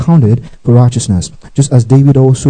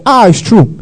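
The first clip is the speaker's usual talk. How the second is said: startled cry; 9 semitones higher than usual. Retold or imitated. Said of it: "He said".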